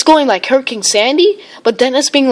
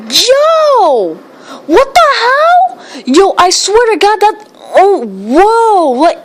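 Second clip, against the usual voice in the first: excited shrieks; 8 semitones higher, much slower than usual. Real, not acted. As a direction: squeal at the storm like it's unreal. Storm winds hit, shrieking.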